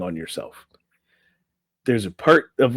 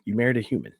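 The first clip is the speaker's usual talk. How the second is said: usual speaking voice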